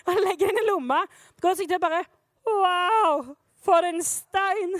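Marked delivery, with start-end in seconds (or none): 2.4-4.7 slow, stretched-out awe; hammed-up dreamy amazement; mock wonder. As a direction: marvel slowly as if at a treasure. Acts David marvelling at a stone.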